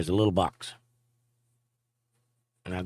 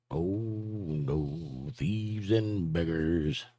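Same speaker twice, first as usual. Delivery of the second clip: mock lament; 7 dB quieter, much slower than usual.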